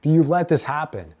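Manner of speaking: loud, high, drawn-out plea to God; anguished questioning. Acted. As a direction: cry out the question, stretching the first word. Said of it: "and you can even ask".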